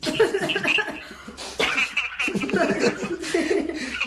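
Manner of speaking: long, high-pitched burst of laughter; real laughter. Real, not acted. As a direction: crack up helplessly at the story. Host cracks up at the story.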